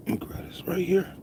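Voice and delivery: soft whisper under his breath. Real, not acted. Whispering to himself while grabbing something, hushed. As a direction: murmur it to yourself.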